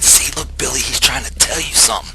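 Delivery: high, loud, insistent exhortation; re-enacted urgent pleading. Preaching friend telling Billy the dog wants him bowing, urgent.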